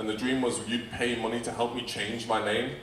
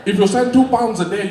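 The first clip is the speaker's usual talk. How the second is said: much higher, louder mock old Japanese man asking for two pounds; Nelson Mandela; 11 semitones higher, 10 dB louder than usual. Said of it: "sound like an old Japanese man".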